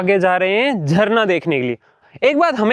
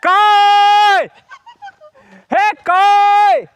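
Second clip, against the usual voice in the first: loud call across distance; 12 semitones higher, 4 dB louder, much slower than usual. Real, not acted. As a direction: shout her name to find her. Calling out to Kai loudly.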